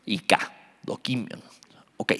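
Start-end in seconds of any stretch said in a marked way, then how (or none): 0.8-1.4 "doquimión" with the stress on the QUI syllable; stress on first I. Shown as a demonstration. Teacher showing the Greek word, "doquimión" stressed on its first i. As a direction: say "doquimión" softly, accenting its first i. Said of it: "Con acento en la primera I".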